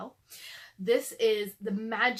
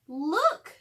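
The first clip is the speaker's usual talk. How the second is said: a louder, higher look; excited girl; 6 semitones higher, 8 dB louder than usual. A narrator's reading. Annie showing Jack the ladder, eager higher voice. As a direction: point it out eagerly. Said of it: "she said".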